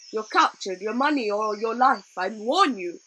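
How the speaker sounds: loud, blustering pirate menace; hammed-up threat. A narrator's reading. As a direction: overplay the fierce pirate. Rambashi demands her money.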